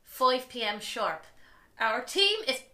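put-on reading out news in an Irish accent; Irish-accented announcement. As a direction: put on an Irish singer's brogue.

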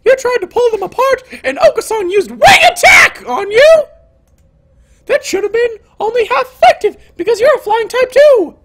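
very high, quick character voice; squeaky disbelief, pitched far up; incredulous heroine. Acted. Heroine questioning her injured classmate, high incredulous voice.

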